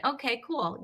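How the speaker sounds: ordinary talk, nothing marked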